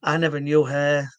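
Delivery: ordinary talk, nothing marked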